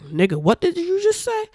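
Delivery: high-pitched mock disbelief; incredulous reaction. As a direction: pitch shooting up, as if stunned by what was said. Acting out a shocked room's reaction.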